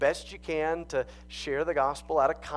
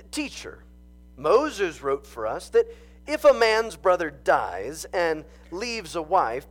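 louder, higher dramatic Bible reading; scripture reading; 5 semitones higher, 4 dB louder than usual. Acted. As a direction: proclaim the passage to the congregation. Reads scripture aloud.

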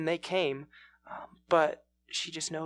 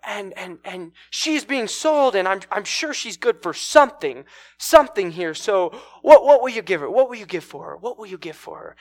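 loud, higher auctioneer's pitch to the crowd; 4 semitones higher, 12 dB louder than usual. Acted. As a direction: hawk it from the stage, halting then pressing for bids. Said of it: "with an auctioneer out there".

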